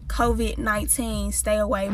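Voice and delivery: low, soft mock warning. Joking warning to the little girl, low and soft. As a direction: murmur it like a joke warning. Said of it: "But I didn't say that".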